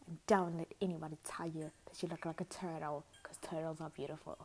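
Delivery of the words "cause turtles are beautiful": ordinary talk, nothing marked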